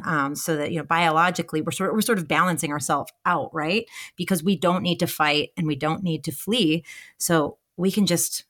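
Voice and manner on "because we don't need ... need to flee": normal talking voice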